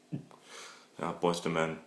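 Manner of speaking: normal talking voice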